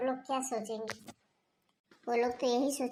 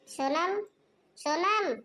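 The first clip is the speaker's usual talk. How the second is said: calling across the house, louder on the second call; hammed-up call for the daughter-in-law; 6 semitones higher, 4 dB louder, much slower than usual. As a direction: shout her name to another room. Mother-in-law calls out daughter-in-law.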